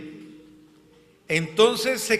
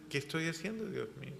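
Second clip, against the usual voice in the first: much softer, to oneself; quiet inner question; 13 dB quieter, much faster than usual. Acted. Believer questioning himself before God, quiet. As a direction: murmur it as a private confession.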